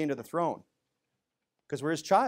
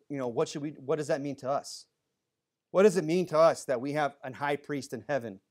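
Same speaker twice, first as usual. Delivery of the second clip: ordinary delivery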